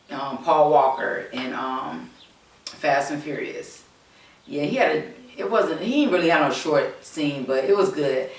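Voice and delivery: normal talking voice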